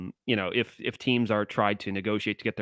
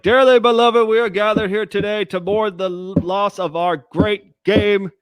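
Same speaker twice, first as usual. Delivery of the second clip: loud, high booming funeral eulogy; Don Cherry eulogy; 10 semitones higher, 9 dB louder than usual. Retold or imitated. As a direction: deliver it like a grand graveside sermon.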